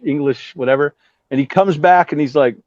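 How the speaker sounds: ordinary talk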